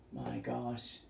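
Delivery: a quiet imitated gasp; hushed mock awe. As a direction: barely say it in awe. Audience reacts.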